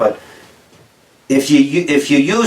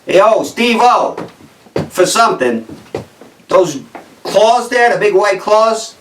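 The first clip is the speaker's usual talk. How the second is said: higher, slow Jersey guy scolding a friend; Jersey accent; 3 semitones higher, slower than usual. Retold or imitated. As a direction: play a Jersey guy chewing out his pal, slowly. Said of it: "used in a sentence like this".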